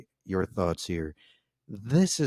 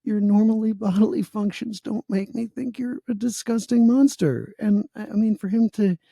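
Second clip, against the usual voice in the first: higher, mock-tearful sarcastic gushing; hammed-up choked-up praise; mock emotional; 12 semitones higher than usual. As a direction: fake being deeply moved, pitched up. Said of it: "I'm getting emotional too".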